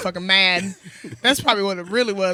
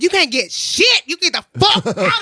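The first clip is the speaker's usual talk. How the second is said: loud, raised retold scolding; reckless telling-off; 6 semitones higher, 5 dB louder than usual. Bartender refuses drunk customer's friend, reckless telling-off. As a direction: punch out a mock-furious dismissal, half laughing. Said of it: "I was just talking mad reckless".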